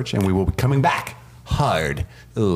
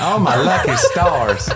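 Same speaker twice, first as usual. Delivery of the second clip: high, slow, louder mock Southern belle gasp through laughter; 8 semitones higher, 5 dB louder, slower than usual. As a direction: swoon it like a hammed-up Southern belle.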